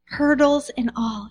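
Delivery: ordinary talk, nothing marked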